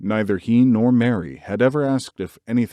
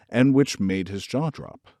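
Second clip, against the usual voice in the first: plain reading voice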